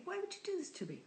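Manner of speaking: high, soft mock despair; imitating exasperated thought. Reacting to a friend's chain letter, high and pained. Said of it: "I think".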